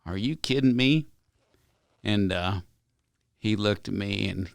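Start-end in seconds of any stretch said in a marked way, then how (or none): 0.0-1.1 higher, louder, incredulous; you can't believe your ears; mock shocked businessman; stunned disbelief. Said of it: "just flipped".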